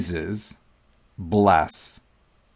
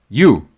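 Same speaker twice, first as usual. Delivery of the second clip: you set apart and louder; stressed you; 7 dB louder than usual. Shown as a demonstration. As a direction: land on you, this one person. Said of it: "Singular you".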